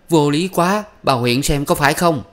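high, loud protest; indignant outcry. A narrator's reading. Beaten young wife crying out to the neighbour, high and loud. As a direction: cry out against the injustice. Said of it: "Mình kêu".

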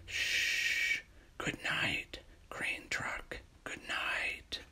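soft bedtime whisper, almost no voice. Acted. Reader whispering goodnight to Crane Truck at bedtime, whispered. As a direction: hush it like a child is asleep.

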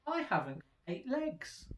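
high squeaky spider protest; lightly voiced small spider. A narrator's reading. Small spider protesting to the cat.

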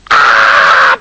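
sharp unvoiced scream after a gasp. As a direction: scream in outraged shock. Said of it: "Beryl screamed and stamped her feet".

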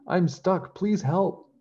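high, loud calling out; shouting for help. A narrator's reading. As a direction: call down to the castle for rescue. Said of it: "she shouted as loudly as she could".

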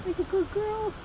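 high, soft praise for the dog; doggy talk. Real, not acted.

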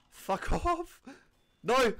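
high shout broken by a laugh; laughing yell. Real, not acted. Gamer killed by a hidden sentry, laughing outraged yell.

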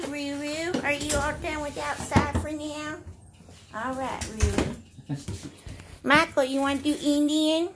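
ordinary delivery

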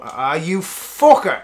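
slow, loud frustrated curse; mock-anguished curse, dragged out. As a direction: drag out a fed-up swear. Cursing on realising missed gig.

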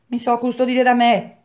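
high, loud defiant cry; a loud shout in defiance. Acted. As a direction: fling it back at them, shouting. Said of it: "gridò".

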